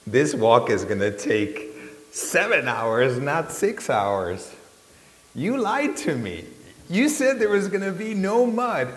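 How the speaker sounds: hammered-out outraged complaint; retold angry outburst at the trip leader; angry rant. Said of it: "I've never seen you angry".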